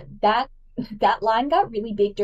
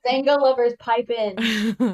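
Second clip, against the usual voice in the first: louder rallying call to fans; mock call-out; 3 semitones higher, 4 dB louder than usual. Host calling on Zenga fans, mock rallying call. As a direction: hype up the listeners. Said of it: "calls to listeners".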